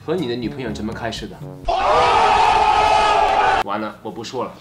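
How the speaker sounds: ordinary talk, nothing marked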